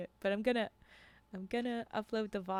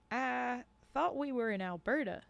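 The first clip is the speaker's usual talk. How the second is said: higher-pitched southern voice; southern accent; 4 semitones higher than usual. Acted. Hunter Bobo questioning the guide's boast, southern accent. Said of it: "now I'm doing a southern accent".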